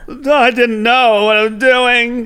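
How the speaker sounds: high, slow, fake American accent; mock helpless hostage in a badly faked American accent; Alan Rickman. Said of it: "his, like, American accent voice".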